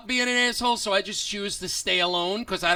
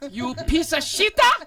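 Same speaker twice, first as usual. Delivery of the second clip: much higher, loud, slowed-down mock yell; imitated screaming passenger; 7 semitones higher, 5 dB louder, slower than usual. Angry woman yelling at the bus driver, hammed-up screaming. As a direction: stretch every shita into a shrill screech. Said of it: "she's yelling at the top of her lungs".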